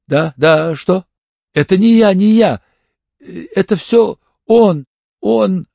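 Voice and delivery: high, loud, frantic protest; panicked denial. A narrator's reading. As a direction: blurt it out in rising panic. Detainee breaks down under questioning. (паническое, сбивчивое отрицание)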